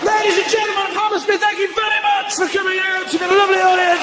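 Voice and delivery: stage sign-off. Real, not acted. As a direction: call out goodnight over applause. Closing the show to audience.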